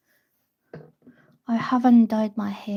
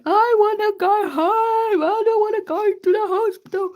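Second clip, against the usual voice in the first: high-pitched, wailing mock protest; imitated crying wail; 10 semitones higher, 3 dB louder than usual. Refusing the hospital to the driver, imitated crying wail. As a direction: wail it like someone crying in panic. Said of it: "crying, screaming".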